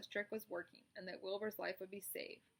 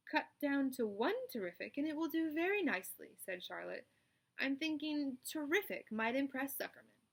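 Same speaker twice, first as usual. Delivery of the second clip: raised, louder spider voice; spider's speech; 6 semitones higher, 4 dB louder than usual. Acted. Charlotte explains her trick to the animals, confident. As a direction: address the barn like a chairwoman.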